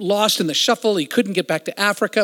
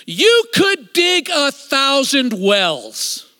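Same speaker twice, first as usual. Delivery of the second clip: slow, higher and louder, each word weighed; earnest and slow; 8 semitones higher, 5 dB louder, much slower than usual. Retold or imitated. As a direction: deliver it as a piercing truth, slowly. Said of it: "He looks me in the eyes and he says".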